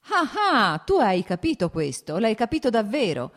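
high, loud burst of triumph; joyful cry. A narrator's reading. Nikolai seizing on his brother's admission, joyful cry. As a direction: cry it out with delight, pitch leaping. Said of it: "gridò con gioia Nikolai".

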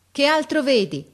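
loud, high officer's query; called-out question. A narrator's reading. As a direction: shout the question upwards.

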